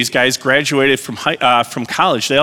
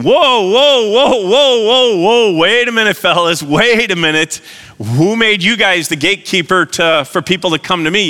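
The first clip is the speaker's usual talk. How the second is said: indignant Jesus halting disciples; 6 semitones higher, 3 dB louder than usual. Acted. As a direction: call out to stop them, then challenge them. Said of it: "What I see Jesus is saying".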